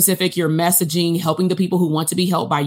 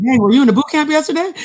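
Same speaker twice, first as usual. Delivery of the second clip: excited; 10 semitones higher, 5 dB louder, faster than usual. Retold or imitated. Retold excited reaction.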